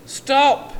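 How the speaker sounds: high, loud yell. A narrator's reading. Seth yelling at the runaway, loud high shout. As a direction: shout after the runner. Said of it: "yelled Seth".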